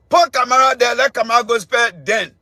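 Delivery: ordinary talk, nothing marked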